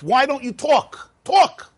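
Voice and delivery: high, insistent push to make him speak; pressing a child to talk. Acted. Adult pushing a silent child to speak, high and insistent. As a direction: coax then demand the word "Talk".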